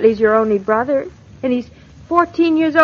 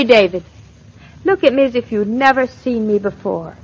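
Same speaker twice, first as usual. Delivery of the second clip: ordinary talk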